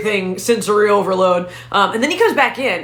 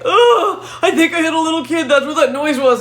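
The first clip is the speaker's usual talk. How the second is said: high, wailing imitation of boyfriend; hammed-up tearful panic; mock sobbing; 7 semitones higher than usual. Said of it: "he just starts sobbing".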